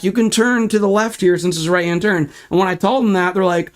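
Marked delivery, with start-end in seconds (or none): none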